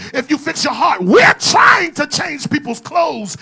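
loud, high preacher shout building; fiery sermon build; full-force preaching. Acted. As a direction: drive the point into the pews.

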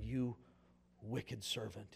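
low, soft, grave rebuke; cold and quiet condemnation. A narrator's reading. Master calling in the unforgiving servant, quiet grave rebuke. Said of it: "he said".

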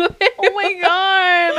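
high, loud and very slow; drawn-out oh my God. Acted. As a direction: stretch it out in mock shock. Reacting to a friend's joke.

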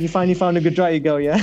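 ordinary delivery

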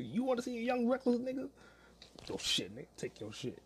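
high-pitched mock threat; mock challenge. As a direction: act out a cocky dare, pitched high. Imagined threat to the old man.

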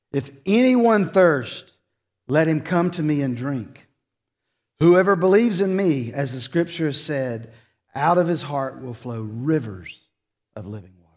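a preacher booming Jesus' invitation; preaching at full force. Acted. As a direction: proclaim the invitation with rising force.

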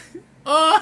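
loud squealing burst of laughter; shrill cackle of laughter; high shrieking laugh. Real, not acted.

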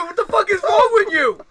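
high, loud, disbelieving; incredulous shout. Real, not acted. Incredulous outburst at cohost.

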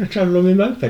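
high and loud, mock disbelief; incredulous repeat. Repeats the absurd answer in the retelling.

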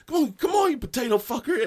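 high-pitched impatient heckle; loud, not yelling. Retold or imitated. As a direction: call it out loud, short of a yell. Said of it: "but he says it loud".

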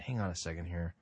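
muttered aside. Real, not acted. Pausing video, talking to himself.

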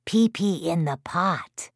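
slow, raspy whispery statue; hushed, drawn-out, raspy secret message. A narrator's reading. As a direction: rasp it out slowly like a bronze statue. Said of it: "in my best raspy, bronze statue-like voice".

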